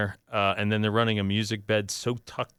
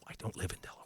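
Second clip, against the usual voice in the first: whispered aside; 4 semitones lower, 18 dB quieter, much faster than usual. Real, not acted. Aside after reading the email.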